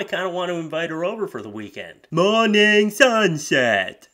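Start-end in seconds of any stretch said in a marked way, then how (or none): 2.1-4.1 higher, louder, very slow; hammed-up dopey voice; dopey poster. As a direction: read it like a proud dimwit. Mock reading dumb post.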